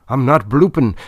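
first mate panicking as the sextant falls silent; note of fear. A narrator's reading. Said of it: "a note of fear entering into his voice".

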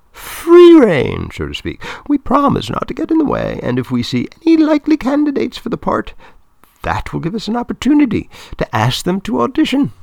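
very high, loud persuasive woman; Claudia, persuading. A narrator's reading. Film woman promising not to get in the way, high and persuasive. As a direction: sell it in a high, projected voice.

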